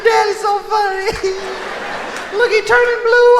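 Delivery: put-on high, laughing child voice; giggling kid. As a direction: squeal it like a delighted kid. Kids laughing at heart attack.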